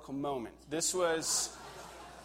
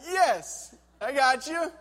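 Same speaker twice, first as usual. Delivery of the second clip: hammed-up reassurance, pitched much higher and louder; mock reassuring Kevin; 9 semitones higher, 7 dB louder, slower than usual. Belayer Kevin reassuring the scared climber, mock-high. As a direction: play the patient belayer, comically. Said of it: "And he's like".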